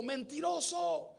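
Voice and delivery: high, slow drawn-out yell; a father yelling liar; shouted insult. Retold or imitated. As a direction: hurl the word across the canyon. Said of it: "Y gritó y dijo".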